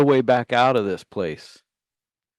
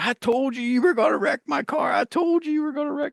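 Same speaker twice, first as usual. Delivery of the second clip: pitched way up, an angry scream; high scream; 14 semitones higher, faster than usual. Retold or imitated. Angry uncle screaming high at the nephew who wrecked. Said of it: "he's screaming".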